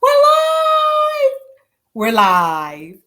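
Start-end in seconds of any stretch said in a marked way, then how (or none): 0.0-1.3 high, loud shouted announcement; excited shout. Real, not acted. Host to livestream viewers, excited high shout. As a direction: belt it out to the viewers.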